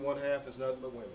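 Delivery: usual speaking voice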